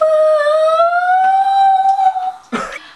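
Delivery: a loud, high, sung-out laugh; high singsong laugh. Real, not acted. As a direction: burst into a long singsong laugh at yourself. Laughing at own retold outburst.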